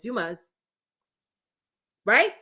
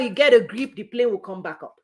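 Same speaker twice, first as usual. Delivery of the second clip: ordinary talk, nothing marked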